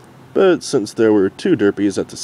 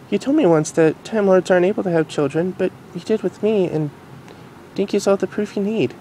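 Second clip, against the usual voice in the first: Future Derpy voice; 10 semitones higher than usual. Acted. Future Derpy telling the Doctor, in a high mare voice. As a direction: voice grown-up Derpy gently.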